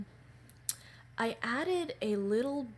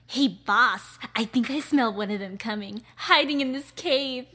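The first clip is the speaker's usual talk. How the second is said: loud, higher lackey voice calling to the boss; eager henchman; 5 semitones higher, 12 dB louder than usual. Acted. Henchman telling the boss he smells one, loud. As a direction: report the scent with eager urgency.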